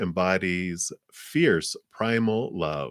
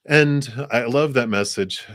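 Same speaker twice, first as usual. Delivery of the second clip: normal talking voice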